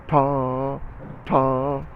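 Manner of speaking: Ta said lightly with the tongue tip. Shown as a demonstration. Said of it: "Very lightly".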